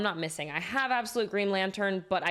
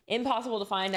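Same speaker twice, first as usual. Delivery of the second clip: ordinary talk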